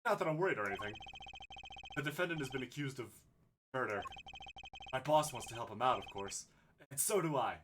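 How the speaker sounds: ordinary talk, nothing marked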